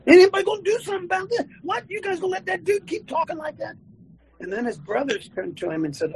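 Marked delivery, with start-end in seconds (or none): 0.1-3.8 high, fast, indignant call; indignant challenge. Retold or imitated. David calling out the army, high indignant challenge. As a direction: rouse the cowards to act.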